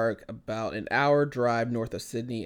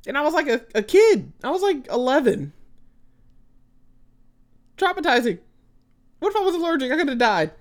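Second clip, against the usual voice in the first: much higher, louder mock outrage; mock dramatic; 11 semitones higher, 6 dB louder than usual. Telling her cohost about the bee sting, melodramatic. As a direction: oversell the trauma for laughs. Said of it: "dramatic about trauma".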